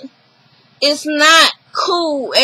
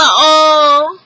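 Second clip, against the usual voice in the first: loud, drawn-out sarcastic pity; sarcastic oh-so-sad; mock sympathy; 4 dB louder, much slower than usual. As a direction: fake sorrow, stretched out slow. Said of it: "And she's like".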